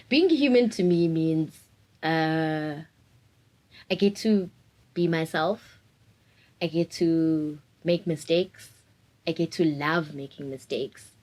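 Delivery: usual speaking voice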